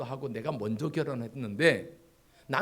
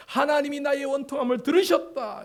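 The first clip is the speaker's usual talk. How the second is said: fervent preaching climax; 11 semitones higher, 8 dB louder than usual. Acted. Pastor proclaiming God heard Leah's cry.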